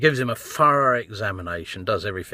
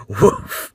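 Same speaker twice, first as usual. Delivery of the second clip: louder and higher mock woof; playful mock dog bark; 7 semitones higher, 9 dB louder than usual. Dog-named vlogger barks.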